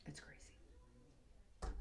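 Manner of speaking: breathy aside under the breath; a hushed whispered aside. Real, not acted. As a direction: whisper it to yourself. Aside while working on hair.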